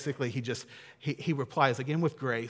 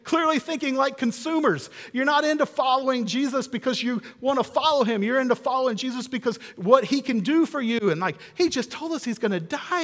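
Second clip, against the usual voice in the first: much higher and louder, a hammed-up rebuke; mock indignant scolding; 9 semitones higher, 3 dB louder than usual. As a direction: scold the selfish brothers in exaggerated outrage. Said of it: "You would think that they would be indignant".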